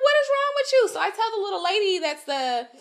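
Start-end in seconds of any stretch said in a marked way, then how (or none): 0.0-0.9 much higher and louder, fed up; high, loud outraged cry; exasperated yell. Retold or imitated. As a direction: shout it at the pushy man. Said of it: "I'm like".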